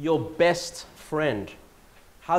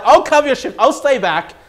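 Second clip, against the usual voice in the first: higher, louder, keen insistence; eager offer; 7 semitones higher, 8 dB louder than usual. Retold or imitated. Offering to cover a colleague's shift, eager louder insistence. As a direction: jump in eagerly to help.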